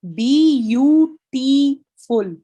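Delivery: loud, slow "बी यू टी फुल", each syllable stretched. Shown as a demonstration. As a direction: count out the four beats of "बी यू टी फुल" loudly and slowly. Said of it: "मुझे four syllables feel हो रहे हैं".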